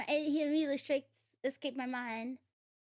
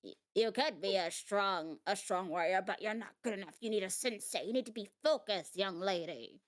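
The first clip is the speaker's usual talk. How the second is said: put-on very old man; 7 semitones lower than usual. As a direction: creak it out like an ancient master. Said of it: "old, old man voice".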